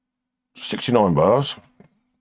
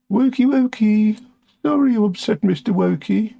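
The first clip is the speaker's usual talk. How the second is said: much higher, teasing sing-song; mock sing-song taunt; 12 semitones higher than usual. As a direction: taunt them like a schoolyard bully. Taunting critics.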